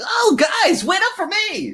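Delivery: very high, loud goofy squeal; silly sidekick voice. Acted. Comic-relief guy calling after the posse, silly and squeaky. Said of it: "the silly voice".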